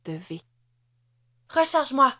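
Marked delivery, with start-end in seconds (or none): 1.5-2.1 higher, louder command; urgent demand. A narrator's reading. Ruby tells Hans to reload her gun, urgent demand. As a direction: demand it forcefully, pitched up. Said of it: "demanda-t-elle en pesant sur l'accélérateur".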